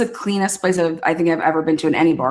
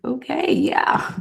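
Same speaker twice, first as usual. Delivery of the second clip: slow whispered, deflated okay; resigned whisper; 6 semitones higher, 4 dB quieter, slower than usual. Retold or imitated. Never using the app again, slow resigned whisper. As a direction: give up quietly.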